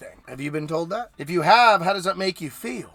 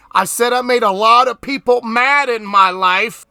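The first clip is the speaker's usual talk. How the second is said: louder and higher, driving it home; forceful restated claim; emphatic loud repeat; 6 semitones higher, 8 dB louder than usual. Real, not acted. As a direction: say it again so they hear you.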